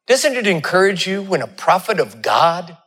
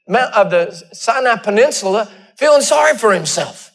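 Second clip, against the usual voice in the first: preaching; 6 semitones higher than usual. Acted. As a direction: lay out each miracle deliberately. Preacher building to a point.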